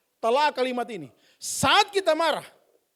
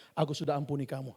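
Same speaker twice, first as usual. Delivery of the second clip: quiet forgiveness; 11 semitones lower, 12 dB quieter than usual. Acted. Forgiving the spouse.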